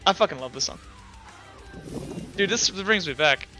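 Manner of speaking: normal talking voice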